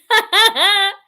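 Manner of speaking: short, loud burst of laughter; real high cackle; amused laugh. Real, not acted. Amused by Mitzi's comment.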